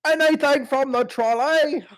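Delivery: very high, slow mock British voice; silly British trolley-seller squeal; British accent. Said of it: "this is my British accent".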